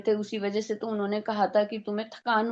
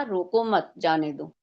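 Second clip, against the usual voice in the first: रोको मत as one unit, then जाने दो; रोको मत grouped together; 3 semitones lower than usual. Shown as a demonstration. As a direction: stress रोको, run मत straight onto it. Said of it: "लेकिन दूसरे वाक्य में कहा".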